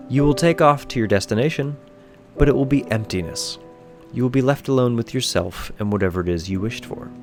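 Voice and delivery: ordinary delivery, nothing marked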